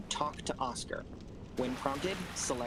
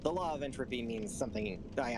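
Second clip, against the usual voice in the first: ordinary talk, nothing marked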